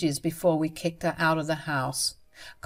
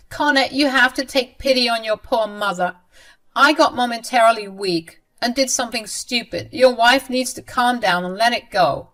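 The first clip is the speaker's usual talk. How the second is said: high, louder self-pitying plea; pleading mother-in-law; 5 semitones higher, 6 dB louder than usual. Acted. Mother-in-law begging her son for pity, high and louder. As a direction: beg for pity.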